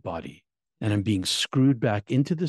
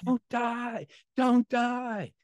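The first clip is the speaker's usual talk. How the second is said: retold frantic plea, voice pitched way up; imitated crying yell; 12 semitones higher, much slower than usual. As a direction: act out a friend's desperate sobbing cries. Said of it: "he's crying, and he's screaming, and he's yelling".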